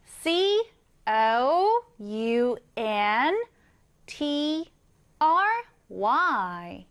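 letter by letter, very slow; slow spelling out. Shown as a demonstration. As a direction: spell each letter slowly for the class. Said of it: "써보겠습니다". (한 글자씩 천천히 철자 읽기)

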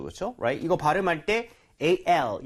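ordinary talk, nothing marked

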